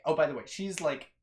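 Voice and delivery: usual speaking voice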